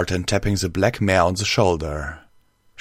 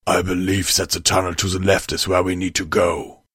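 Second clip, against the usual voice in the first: slightly altered timbre, even pitch; lightly voiced stallion. A narrator's reading. Stallion shows the map to the leader, lightly voiced character. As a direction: report the route in a lightly put-on character voice.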